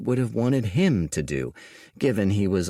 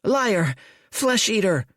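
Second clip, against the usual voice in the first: put-on high, shrill boy's yells; the village boy screaming at a supposed devil; accusing shout; 12 semitones higher than usual. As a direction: hurl the insults at him.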